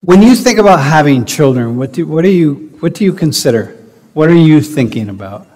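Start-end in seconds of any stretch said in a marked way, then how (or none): none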